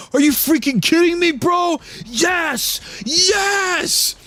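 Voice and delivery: very high, gleeful yells; yelling boatman. Acted. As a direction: cheer wildly. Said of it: "the bony boatman yelling".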